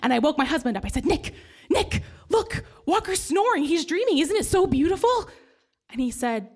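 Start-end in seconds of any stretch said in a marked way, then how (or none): 1.1-5.3 higher, delighted gushing; gushing over the dreaming cat; excited. Retold or imitated. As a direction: wake him up in wonder. Excitedly waking husband.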